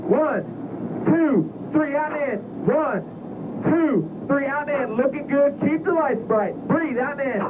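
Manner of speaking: very high, urgent cadence callouts; intense breathing coach. Real, not acted. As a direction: drive him hard through each breath. Instructor calls breathing during six G run.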